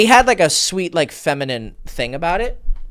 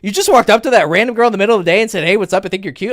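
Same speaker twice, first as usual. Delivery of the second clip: higher, louder, fast stunned retelling; a friend who can't believe it, rattling on; astonished friend; 10 semitones higher, 9 dB louder, faster than usual. Retold or imitated. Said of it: "He's like".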